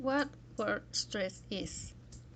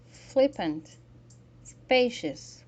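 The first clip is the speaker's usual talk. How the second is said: "flippant", "spacious", loud on the first syllable; "flippant", "spacious", spaced out and front-stressed; 2 semitones higher, 5 dB louder, much slower than usual. Shown as a demonstration. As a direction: punch the start of "flippant" and "spacious". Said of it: "Most two syllable adjectives".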